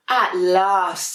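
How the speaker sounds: finally alone, pleased; relieved penguin. Acted. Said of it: "said Dudley".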